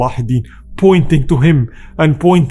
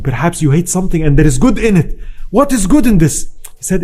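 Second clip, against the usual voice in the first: normal talking voice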